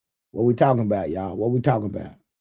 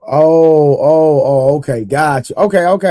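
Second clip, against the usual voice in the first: sudden realization; 4 semitones higher, 7 dB louder, slower than usual. Real, not acted. Realizing chatter meant well.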